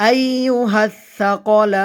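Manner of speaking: "সাকলান" chanted, last vowel held very long; three-alif elongation. Shown as a demonstration. Teacher showing learners "সাকলান" with a long-held ending. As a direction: stretch the "লান" of "সাকলান". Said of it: "তিন আলিফ পরিমাণ টেনে পড়তে হবে".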